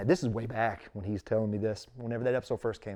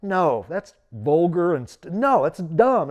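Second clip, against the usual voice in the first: disapproving wife; 6 semitones higher, 10 dB louder, slower than usual. Retold or imitated. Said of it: "she was still like".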